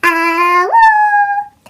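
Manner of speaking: high, loud rising howl; dog howl. Acted. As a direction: throw back your head and howl. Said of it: "she howled".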